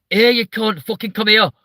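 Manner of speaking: loud, high-pitched Manchester yell; Mancunian street greeting. Retold or imitated. Comic mimics Manchester locals greeting each other, high Mancunian holler. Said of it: "hearing the way that people talk to each other".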